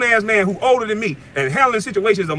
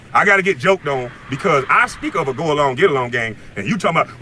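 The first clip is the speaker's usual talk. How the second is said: ordinary talk, nothing marked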